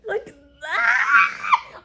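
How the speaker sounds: high, loud squeal with a laugh; a happy overwhelmed scream; squealing laugh-shout. Real, not acted. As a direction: let out a fangirl shriek. Overwhelmed by the book.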